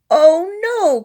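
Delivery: high, loud dismayed cry; cried out. Acted. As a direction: wail it in alarm. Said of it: "cried the Weedle".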